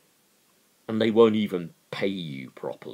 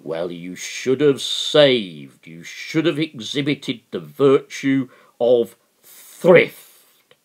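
slow, louder, sanctimonious preaching; mock Tory lecture; 3 semitones higher, 8 dB louder than usual. Tories telling working people to save, slow mock-sanctimonious. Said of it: "they have the gall to say".

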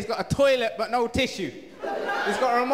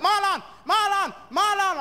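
much higher, loud repeated calls; mum calling upstairs; 10 semitones higher, 3 dB louder than usual. Retold or imitated. Mum calling her son from downstairs, higher and loud. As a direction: call his name up the stairs like a nagging mum. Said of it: "She's like".

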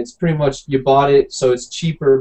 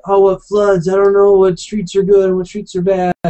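mom voice; 5 semitones higher, 3 dB louder than usual. Retold or imitated. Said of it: "my mom is sitting there like".

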